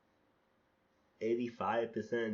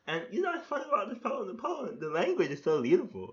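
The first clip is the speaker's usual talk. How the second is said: excited praise; 9 semitones higher, 6 dB louder than usual. Real, not acted. Praising the poem's language.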